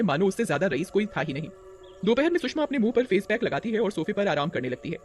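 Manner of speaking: usual speaking voice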